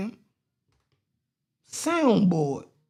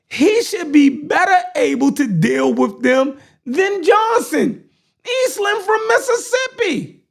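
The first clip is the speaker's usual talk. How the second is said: high, impassioned oratory, key words shouted; heated rally speaker; fiery political speech; 11 semitones higher, 5 dB louder than usual. Acted. Delivers the speech, building in heat.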